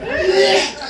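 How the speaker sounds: sudden high-pitched outburst; mock yell. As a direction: yell it as an absurd acceptance speech. Acted award winner yelling.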